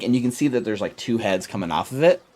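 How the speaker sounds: normal talking voice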